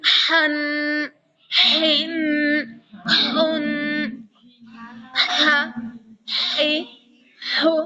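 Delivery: ہن ہا ہی ہو with a throaty puff of air; breathy ha drill. Shown as a demonstration. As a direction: breathe out each ہن ہا ہی ہو. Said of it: "اس طرح سے". (ہن ہا ہی ہو حلق سے ہوا کے ساتھ)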